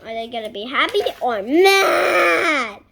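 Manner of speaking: loud wailing shout; loud anxious shouted question. Real, not acted. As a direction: cry out the question.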